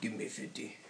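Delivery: weak mock Russian accent, said quietly; failed Russian accent. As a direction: botch a Russian accent on purpose. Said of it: "I'm gonna try to do my Russian accent".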